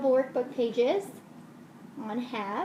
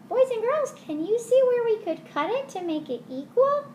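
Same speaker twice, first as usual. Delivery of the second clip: much higher, louder host voice; kids show host; 10 semitones higher, 5 dB louder than usual. Acted. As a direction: call out to the kids brightly. Addresses kids.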